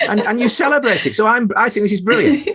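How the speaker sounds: high, giggly teasing through laughter; laughing tease. Real, not acted. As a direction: tease her while laughing. Host teasing guest, laughing.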